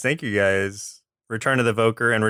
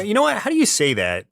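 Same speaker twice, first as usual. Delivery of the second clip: ordinary delivery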